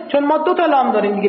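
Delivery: ordinary delivery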